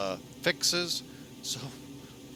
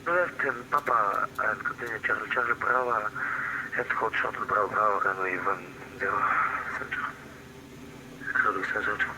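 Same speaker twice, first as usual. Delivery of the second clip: pilot over the radio. Real, not acted. Pilot reads back taxi clearance.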